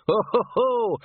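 high, loud laughing "ho ho ho"; old man's cackle. Acted. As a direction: cackle it like a gleeful old man. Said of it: "The old man cackled again".

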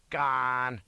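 loud, drawn-out scoff; growled retort. A narrator's reading. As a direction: growl it out as a crowd of soldiers would. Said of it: "growled and said".

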